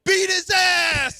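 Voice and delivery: put-on high, loud, slow kids' shout; yelling. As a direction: shout it like a crowd of kids. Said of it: "They're yelling that out".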